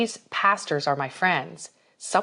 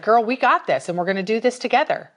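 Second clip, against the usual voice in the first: higher and louder, a cheerleader rallying a friend; peppy encouragement; 6 semitones higher, 5 dB louder, faster than usual. Retold or imitated. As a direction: hype her up, girl. Church lady's pep talk quoted.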